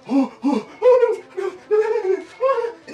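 high, loud panicked "no"s; panicked high cries. Real, not acted. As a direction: yelp from the icy shock. In ice bath, panicking.

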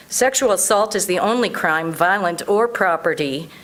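normal talking voice

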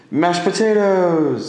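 drawn-out high winner announcement; announcer reveal. Pretend. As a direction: announce it like a game show host.

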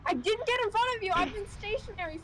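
fast, high-pitched protest; high, rapid self-defence; indignant denial. Real, not acted. Denies blame for crash.

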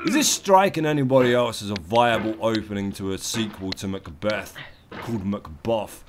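ordinary talk

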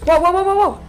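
loud, high string of whoas; startled. Real, not acted. Gamer startled while hunting the animatronic, alarmed. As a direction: blurt it out in alarm.

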